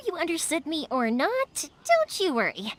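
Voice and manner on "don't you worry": ordinary talk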